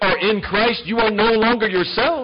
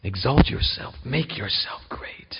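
whispered temptation; tempting whisper; 6 semitones lower, 5 dB quieter than usual. Acted. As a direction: breathe it like the tempter. Serpent's temptation echoed.

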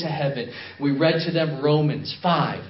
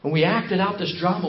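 ordinary talk, nothing marked

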